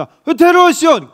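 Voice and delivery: loud, high order: bring him here; commanding call. Acted. Samuel demands the youngest son. (큰 소리로 높게 명령하듯)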